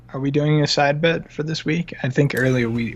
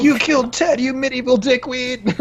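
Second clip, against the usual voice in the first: movie quote; 12 semitones higher than usual. Retold or imitated. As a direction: shout it like Keanu's character. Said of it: "where he's like".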